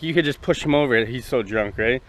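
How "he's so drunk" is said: ordinary talk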